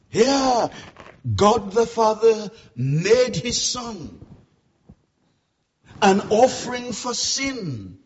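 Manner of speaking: loud, swelling pulpit preaching; preaching at full force. Acted. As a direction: build the sermon to a roaring climax.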